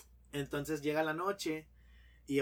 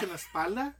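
ordinary talk, nothing marked

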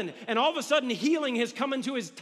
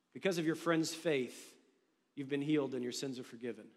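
tender Jesus; 8 semitones lower, 7 dB quieter than usual. Acted. Jesus speaks softly to healed man.

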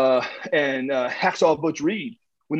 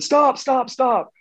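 pleading; 9 semitones higher, 3 dB louder, slower than usual. Retold or imitated. Nord begging with thumb in eye.

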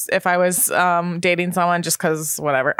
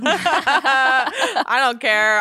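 laughing; 8 semitones higher, much slower than usual. Real, not acted. Laughing admission about shaving.

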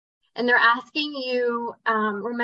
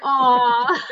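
normal talking voice

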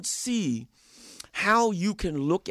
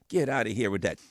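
usual speaking voice

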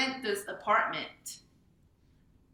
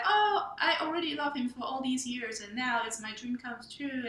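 higher-pitched imitation of a smitten bride; dreamy delight; 4 semitones higher than usual. Author voices her protagonist, in love for years, mock dreamy delight. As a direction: voice her crush finally paying off, pitched up. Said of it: "So Allison is like".

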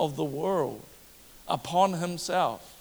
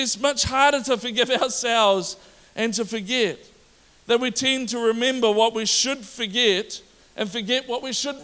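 raised, insistent sermon voice; preaching; 5 semitones higher than usual. Acted. Pastor preaching hard to his congregation, raised and insistent. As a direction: preach it out to the church.

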